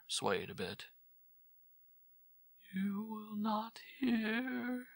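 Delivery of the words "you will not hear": much higher, slow, hushed whimper. Acted. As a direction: whimper in hopeless defeat. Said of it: "she whimpered".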